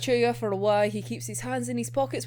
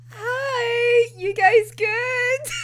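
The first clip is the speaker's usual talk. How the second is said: audacious greeting; 11 semitones higher, 4 dB louder, much slower than usual. Retold or imitated. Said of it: "be like".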